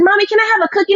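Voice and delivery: a tired mom's daughter asking for a cookie; little girl voice. Retold or imitated. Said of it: "And Alana said".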